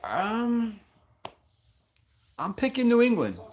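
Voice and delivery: high, put-on pet voice; squeaky voice for Rebel, answering; Rebel's voice.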